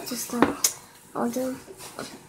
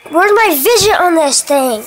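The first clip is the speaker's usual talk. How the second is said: raised voice, searching for something; calling out; 7 dB louder than usual. Real, not acted. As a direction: call out loudly for a lost toy. Calling out for lost fidget.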